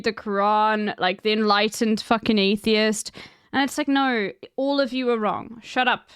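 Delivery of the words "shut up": ordinary delivery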